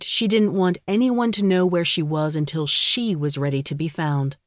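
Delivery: ordinary delivery, nothing marked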